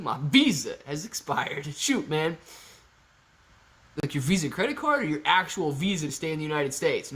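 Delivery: normal talking voice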